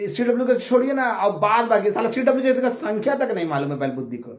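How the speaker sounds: high, heated scornful rant; angry outburst. Real, not acted. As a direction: lash out at a fool, pitched high. Ranting insult at critics. (ऊँची आवाज़ में गुस्से भरा ताना)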